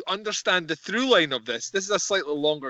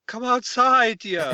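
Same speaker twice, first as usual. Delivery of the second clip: villain disguised as a father, higher pitched; Dark Helmet; 5 semitones higher, 3 dB louder, slower than usual. Retold or imitated. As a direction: call her out in a put-on fatherly voice. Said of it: "Dark Helmet in disguise".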